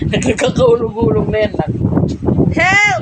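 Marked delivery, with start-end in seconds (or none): 2.6-3.0 high playful mock cry for help; pretend cry for help. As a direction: call out to big sister as if in danger. (kunwaring humihingi ng tulong sa mataas na boses)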